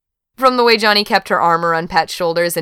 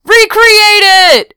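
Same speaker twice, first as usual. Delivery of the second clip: high, loud, drawn-out yell; stretched shout, far above normal pitch; yelled demand; 10 dB louder, slower than usual. A narrator's reading. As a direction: holler it across the table. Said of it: "Andy yelled to Johnny".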